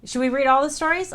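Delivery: excited to read every story; eager question. Real, not acted. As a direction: pitch the idea with excitement. Excited suggestion.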